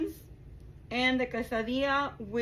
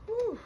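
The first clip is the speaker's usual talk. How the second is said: sharp whew; 13 semitones higher than usual. Real, not acted. Cooling off after touching hot meat.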